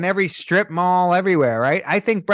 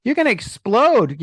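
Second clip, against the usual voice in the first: mock disbelief; 7 semitones higher, slower than usual. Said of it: "I'm like".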